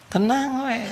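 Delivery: high sing-song "Tenang, we"; mock calm. Husband calming wife, high mock soothing. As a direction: soothe with a lilt.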